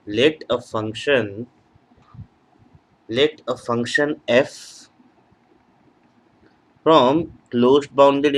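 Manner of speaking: teacher dictating word by word, very slowly; slow dictation. Acted. Teacher writing a theorem for students, slow dictation. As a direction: spell it out slowly as you write on the board.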